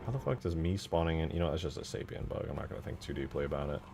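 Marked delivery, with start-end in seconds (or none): none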